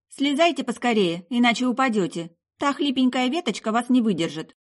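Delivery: high-pitched, urging warning; young woman's lifted, bossy warning; girl calling up. Acted. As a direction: urge him down from the branch. Girl calls up to werewolf in tree.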